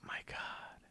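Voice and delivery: unvoiced, breathless oh my God; awed whisper. Real, not acted. Marvelling at the truck's smooth new ride, whispered in awe. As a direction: hush it out in awe.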